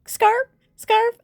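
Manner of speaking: squeaky high seal barking the word scarf; cute seal yelp. Retold or imitated. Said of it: "I like how he's like".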